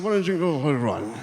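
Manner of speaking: slurred, rushed and tipsy; mumbling it like a drunk who's had too many. Acted. Drunk man slurring his question back.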